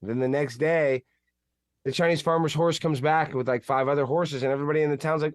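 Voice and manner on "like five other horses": ordinary talk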